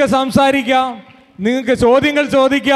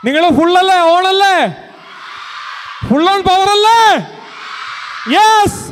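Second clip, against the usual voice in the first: hyped crowd call; 7 semitones higher than usual. Real, not acted. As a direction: pump up the crowd. Anchor hyping up the crowd. (ആവേശത്തോടെ കാണികളെ ഉണർത്തുന്നു)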